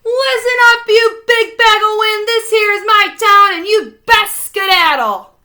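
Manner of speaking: high, booming showdown; loud challenge. A narrator's reading. Holler ordering the tornado out of his town, booming. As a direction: plant your feet and order it out of town.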